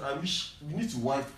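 normal talking voice

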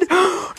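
high, dramatic gasp; sharp gasp. Acted. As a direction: gasp at the shocking twist. Reacting to violent story twist.

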